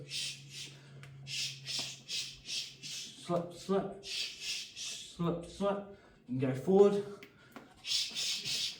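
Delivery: hissed breaths with slips called out; sharp punch exhales. Shown as a demonstration. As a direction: exhale hard on each punch and slip. Shadowboxing combo with exhales.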